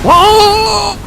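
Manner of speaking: high, loud cry of shock; startled shout. Real, not acted. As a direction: yelp in sudden alarm. Boss appears.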